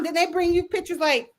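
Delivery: ordinary talk, nothing marked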